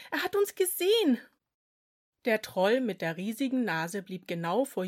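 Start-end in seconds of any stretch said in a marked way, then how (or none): 0.1-1.2 scared. A narrator's reading. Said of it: "flüsterte Tran ängstlich".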